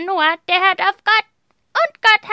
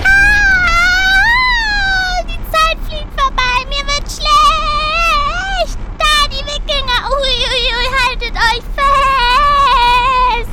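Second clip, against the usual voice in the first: high, excited and queasy; thrilled ride cries; 6 semitones higher, 4 dB louder, much slower than usual. Acted. Riding through time with the children, high and excited. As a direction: ride the time warp out loud.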